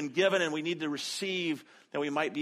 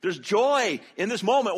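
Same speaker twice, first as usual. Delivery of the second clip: joyful urging; 4 semitones higher, 4 dB louder than usual. Retold or imitated. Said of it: "but they were saying".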